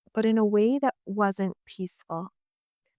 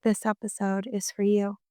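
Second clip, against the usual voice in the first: calm, measured delivery with long pauses; soothing, unhurried reassurance, phrase by phrase; gentle guided meditation. Real, not acted. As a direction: guide a listener gently, leaving space between phrases. Gentle reflective podcast with guided breathing.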